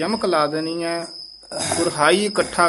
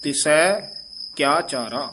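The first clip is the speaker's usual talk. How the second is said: rising "ਤਿਸੈ", pause, then "ਕਿਆ ਚਾਰਾ"; phrasing demo. Shown as a demonstration. Teacher showing the rests, deliberate split phrase. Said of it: "ਇੱਥੇ ਵਿਸਰਾਮ".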